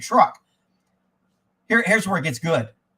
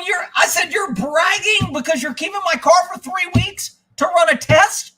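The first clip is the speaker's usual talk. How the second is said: furious yell at the bragging manager; outraged shout; 11 semitones higher than usual. Retold or imitated. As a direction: scream the disbelief, almost crying.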